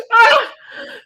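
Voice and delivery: loud, high burst of laughter. Real, not acted. Streamer laughs at being teased over her accent, loud and high.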